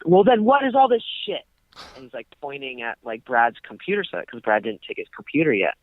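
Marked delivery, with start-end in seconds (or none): 0.1-1.4 high, indignant mock outburst; imitating angry landlord. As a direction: play the outraged intruder, pitched way up. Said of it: "And then he's like".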